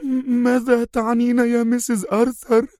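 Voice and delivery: high, stammering frightened question; sinking dread. Acted. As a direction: heart dropping as she asks. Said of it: "هوى قلب المربية بين قدميها".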